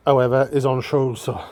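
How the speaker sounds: normal talking voice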